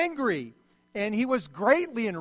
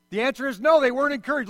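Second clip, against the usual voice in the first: higher, louder, punch the NO; emphatic answer; 5 semitones higher, 7 dB louder, faster than usual. Real, not acted. Preacher answering the congregation, loud emphatic no. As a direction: slam the answer home to the crowd.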